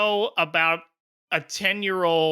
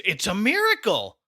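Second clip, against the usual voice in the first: excited exclaim; 3 semitones higher than usual. Retold or imitated. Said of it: "Dave exclaims".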